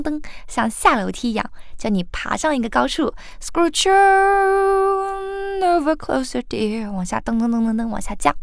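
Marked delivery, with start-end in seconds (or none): 3.4-6.9 "Scrooge on" lifted high and slow; pulled-up pitch. Shown as a demonstration. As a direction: stretch the tune upward on "on". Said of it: "这样夸张的模仿". (夸张地往上拉音)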